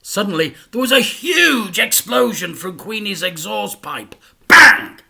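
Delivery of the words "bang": ordinary delivery, nothing marked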